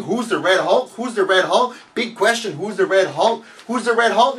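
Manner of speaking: mocking hype. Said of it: "I'm like".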